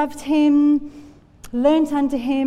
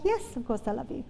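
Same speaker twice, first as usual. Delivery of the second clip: quick, much softer answer; soft reply; 10 dB quieter, faster than usual. Acted. Peter answers Jesus asking if he loves him, soft reply. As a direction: say it softly and quickly, as an easy reassurance.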